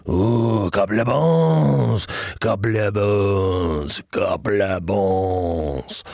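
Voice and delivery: put-on slow, savoring Mediterranean old gentleman; Mediterranean accent. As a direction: relish every word with a Mediterranean accent. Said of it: "like I'm an older gentleman from some unspecified Mediterranean".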